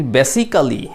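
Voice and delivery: basically said as "be-si-ka-li"; four-syllable basically with a full "ka"; wrong pronunciation. Shown as a demonstration. Teacher warning learners against it, mispronounced basically as "be-si-ka-li". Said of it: "এরকম করে বলবেন না".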